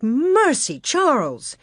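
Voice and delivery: startled high exclamation; gasping. Acted. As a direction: gasp out the words in alarm. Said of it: "Ma gasped".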